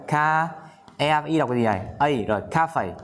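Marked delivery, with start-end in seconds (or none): 0.1-0.5 "Ca" held high as the stressed syllable; high, emphasized "Ca"; stressed first syllable. Shown as a demonstration. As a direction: lift and stretch "Ca", the start of cafe. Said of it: "nhấn đầu lên".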